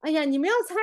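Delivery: ordinary talk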